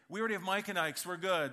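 loud and high, blurting out; loud kid voice. Retold or imitated. Son announcing it again at the counter, loud. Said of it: "He says it again".

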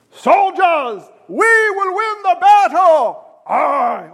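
loud, high British commander rallying troops; slow, drawn-out war speech in a British accent; British accent battle cry. Acted. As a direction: shout it like a British general addressing soldiers. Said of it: "Always a British accent with me".

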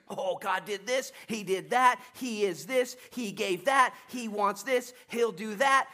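high, loud, rising preacher build; excited preaching. Acted. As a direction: preach it with mounting fire. Rapid list of God's deeds.

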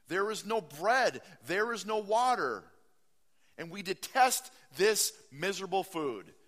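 hammed-up protest at Moses; grumbling Israelites.

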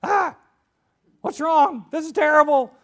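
high, loud, panicky scarecrow. Retold or imitated. Preacher acting out the scarecrow's panic in a high yelp. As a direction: squeal it like a frightened straw man.

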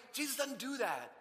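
normal talking voice

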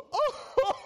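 a high, loud hammed-up Oh between laughs; mock overjoyed Joseph. Pastor acting Joseph overcome before his brothers, hammed-up cry. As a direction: burst out with a laughing, overwhelmed Oh. Joseph bursts out weeping, acted.

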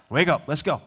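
lightly voiced angel, quick and urgent; a touch of an urgent angel; urgent angel's call. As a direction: hurry him up out of the cell. Angel urging Peter out.

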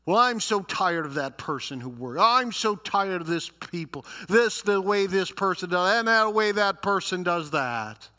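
hammed-up exasperated grumbling; mock griping. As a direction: imitate a fed-up complainer reeling off gripes. Acts people griping about others.

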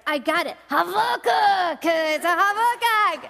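nail "Habakkuk" as Havakuk, proud and loud; triumphant Habakkuk. Retold or imitated. Triumphant new pronunciation.

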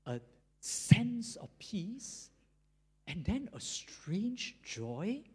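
usual speaking voice

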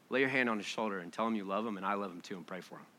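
quick quiet inner voice. Acted. Jesus' quiet instruction.

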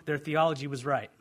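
ordinary talk, nothing marked

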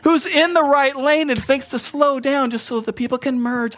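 high, loud, slow-starting exasperated rant at a slow driver. Retold or imitated. Pastor griping at a slow merging driver, exasperated and loud. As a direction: vent at the dude blocking the lane.

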